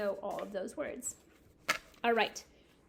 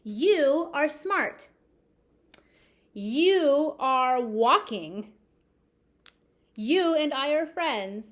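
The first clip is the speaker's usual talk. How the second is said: louder and slower, each word stretched; loud, slow example sentences; 4 semitones higher, 9 dB louder, much slower than usual. Shown as a demonstration. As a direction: present the sentences to the class. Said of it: "example sentences to class".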